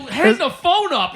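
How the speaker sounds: high, loud, slow insistence; urgent command. Retold or imitated. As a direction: order it urgently. Urgently telling friend to stop.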